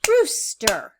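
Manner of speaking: Rooster split into two beats, ROOS, then STER; syllable by syllable. Shown as a demonstration. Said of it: "Two syllables".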